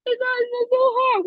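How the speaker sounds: mock Allie from The Notebook, way up high. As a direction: squeak it out like the torn movie heroine. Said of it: "Allie's all".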